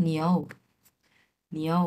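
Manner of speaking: নিউ said as n plus iou, one smooth syllable; correct niu. Shown as a demonstration. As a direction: model নিউ the right way. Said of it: "তাহলে কি হবে?".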